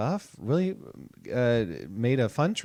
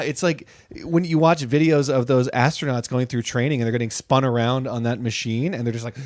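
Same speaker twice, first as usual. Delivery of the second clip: ordinary talk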